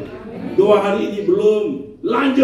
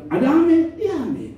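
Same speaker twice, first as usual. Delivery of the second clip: imitated congregation reply; 9 semitones higher than usual. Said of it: "Kalau orang Kupang itu dia bilang".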